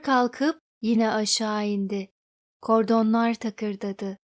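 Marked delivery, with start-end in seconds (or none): none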